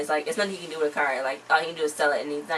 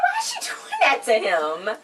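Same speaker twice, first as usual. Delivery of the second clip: laughing disbelief; 3 semitones higher than usual. Real, not acted. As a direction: squeal it out, cracking up. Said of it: "I was like".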